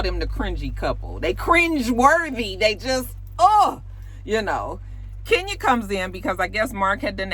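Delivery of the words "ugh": loud, high "ugh" of cringing; disgusted ugh. Real, not acted. Vlogger reacting to the cringey couple, disgusted ugh.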